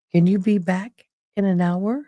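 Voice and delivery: usual speaking voice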